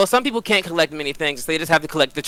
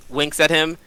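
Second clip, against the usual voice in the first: ordinary delivery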